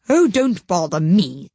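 rough, haughty duchess voice; gruff duchess. A narrator's reading. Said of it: "said the Duchess".

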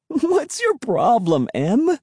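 high-pitched, amused teasing; laughing taunt. A narrator's reading. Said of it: "I laughed".

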